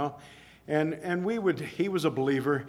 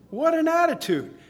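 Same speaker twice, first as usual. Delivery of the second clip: excited preaching; 9 semitones higher, 5 dB louder than usual. Real, not acted. Preacher exclaiming in admiration.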